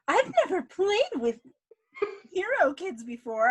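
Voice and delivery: put-on high, loud kid voice, slightly unhinged; loud, high-pitched mock child on the edge of insanity; almost-insane kid. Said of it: "almost insane, but still like a kid".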